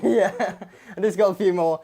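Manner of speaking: chuckling at her own pun; laughing. Real, not acted. Host laughing at her soup joke to viewers, laughing.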